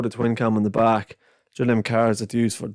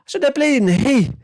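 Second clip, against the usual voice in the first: put-on much higher, louder childish rant; kid's rant; 10 semitones higher, 3 dB louder than usual. Boy ranting about empty shelves, high and loud. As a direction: splutter like an outraged little kid. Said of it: "he goes".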